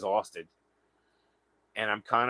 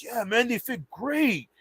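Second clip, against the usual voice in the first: higher, slow, pleased imitation; stranger's voice; 11 semitones higher, slower than usual. Stranger trying on moccasins, slow high pleased voice. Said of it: "He goes".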